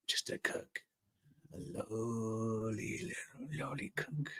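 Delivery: slow voiceless conspiratorial whisper. Acted. Whispering a joke to chat about Steven Seagal's cook role. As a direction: whisper it as a sly punchline, slowly.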